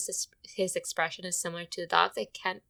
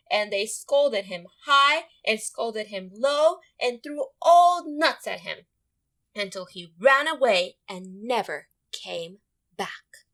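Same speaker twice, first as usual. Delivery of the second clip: rousing storytelling; 2 semitones higher, 7 dB louder, slower than usual. Acted. Squirrels chase bear away.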